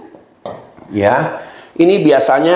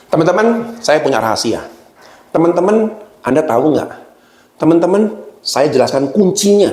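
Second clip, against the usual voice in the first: higher, rising calls to "Teman-teman"; engaging trainer hook; 5 semitones higher than usual. Shown as a demonstration. Trainer hooking the audience, higher rising teasing calls. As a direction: tease the room with a secret. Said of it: "Misalnya contoh dengan bahasa".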